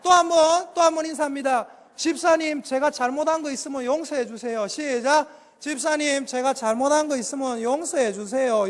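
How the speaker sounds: loud, driving sermon voice; fiery Korean pastor preaching; preaching at full force. Acted. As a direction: preach it hard to the congregation.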